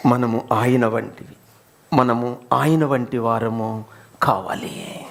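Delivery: slow, breath-only whisper; solemn whisper. Real, not acted. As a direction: draw them close with a hush. Solemn point about being like Christ. (గుసగుసగా గంభీరంగా)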